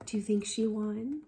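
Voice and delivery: soft, far aside to the listeners; hushed question. Shown as a demonstration. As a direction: reader pausing to ask the children, softly. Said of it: "question to child listeners".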